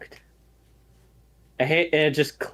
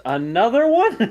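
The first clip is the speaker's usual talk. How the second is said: high, loud Another one; stunned question; 11 semitones higher, 9 dB louder than usual. Acted. George staring at Hex over the pregnancy test, loud shocked question. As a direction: blurt it out in shock. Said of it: "looks at Hex and goes".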